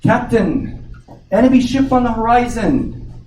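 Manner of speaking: a sailor's alert, pitched high; lookout calling down. Acted. Lookout calls to the captain, high alert call. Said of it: "the lookout in the crow's nest says".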